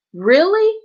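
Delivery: high, loud outraged disbelief; can you believe this man; sharp rising "Really?" in disbelief; incredulous. Real, not acted. Outraged at abusive father.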